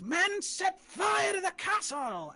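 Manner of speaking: high, slow commanding shout; mayor's command. Acted. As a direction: order the men to burn it. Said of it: "and he says".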